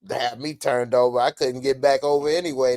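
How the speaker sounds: normal talking voice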